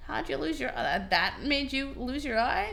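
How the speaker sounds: bartender voice. Acted. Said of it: "he said".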